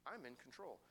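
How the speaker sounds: quiet calm promise; hushed. Acted. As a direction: say it gently, almost under the breath. God's words, hushed take.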